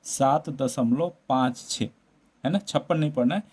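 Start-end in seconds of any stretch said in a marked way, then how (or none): 0.0-1.9 slow demo reading. Shown as a demonstration. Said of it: "Hindi में क्या पढ़ेंगे".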